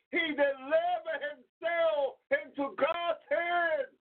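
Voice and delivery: high, impassioned preaching about pain; fervent preaching. Acted. Preacher pleading with brothers and sisters, impassioned and high. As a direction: pour the hurt out to the church.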